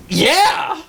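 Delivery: high, emphatic mock outburst; retold emphatic yeah. Hunter answering who shot, high exasperated yeah. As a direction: answer as if it were obvious.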